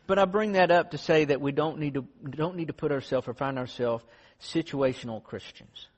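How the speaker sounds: ordinary talk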